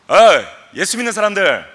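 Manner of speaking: loud heckling call to believers; loud mock taunt. Preacher shouts a scornful taunt for the congregation. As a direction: bark it like a street heckler. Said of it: "자, 봅시다".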